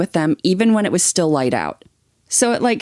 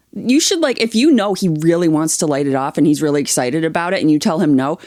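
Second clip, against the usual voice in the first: ordinary talk, nothing marked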